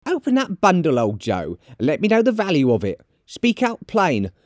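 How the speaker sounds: high, insistent character voice; high-pitched, unashamed woman; brazen charwoman. Acted. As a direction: urge him on in a high voice. Charwoman urges old Joe on.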